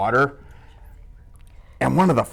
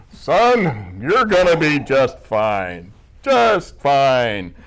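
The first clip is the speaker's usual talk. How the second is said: unbothered dad; 3 dB louder, much slower than usual. Retold or imitated. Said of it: "And he's like".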